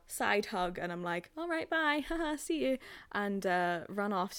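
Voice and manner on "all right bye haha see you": high, fake-cheery farewell; mock nervous brightness with a small laugh; pitched-up polite escape; awkward goodbye. Acting out awkward hurried goodbye.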